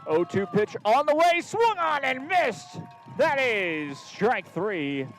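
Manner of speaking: loud, rising strikeout call, high; booming pumped announcer. Real, not acted. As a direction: belt out the strikeout.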